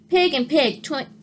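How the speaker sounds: usual speaking voice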